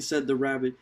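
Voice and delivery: plain reading voice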